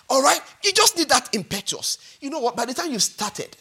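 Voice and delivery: normal talking voice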